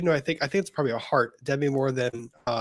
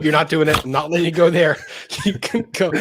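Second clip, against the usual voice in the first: louder, laughing no-no; laughing protest; 2 semitones higher, 6 dB louder than usual. Real, not acted. Stopping her cohost's cockpit joke, loud and laughing. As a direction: shut the joke down through a laugh.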